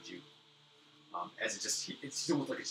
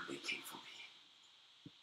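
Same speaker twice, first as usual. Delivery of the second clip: low, slow, breathy whisper; feeble whisper; 6 semitones lower, 11 dB quieter, much slower than usual. Acted. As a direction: let it fade to a dying breath. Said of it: "in his feeble breath".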